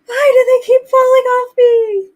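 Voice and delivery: high, loud panicked shriek; frantic cry. Retold or imitated. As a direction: shriek it like you're losing your mind. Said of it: "freaking out".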